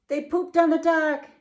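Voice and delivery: put-on two girls shouting the reveal; girls shouted. A narrator's reading. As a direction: shout it like excited little girls. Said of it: "Fiona and Josephine shouted".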